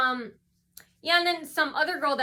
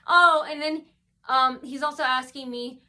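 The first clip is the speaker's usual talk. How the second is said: usual speaking voice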